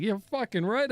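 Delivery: high, emphatic mock brag; playacted emphatic self-congratulation; proud boast. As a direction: burst out with cocky pride, pitch jumping up. Acts out proudly owning up to Harvard.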